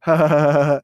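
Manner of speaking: mock stoner chuckle in a quick string; stoned laugh. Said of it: "Someone just high as balls being like".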